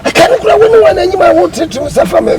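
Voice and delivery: high, loud furious yell; angry shout. Acted. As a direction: scream it at him in rage. Quarrel, shouting at someone.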